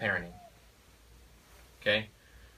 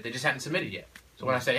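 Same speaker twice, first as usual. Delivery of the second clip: usual speaking voice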